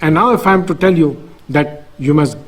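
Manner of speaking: normal talking voice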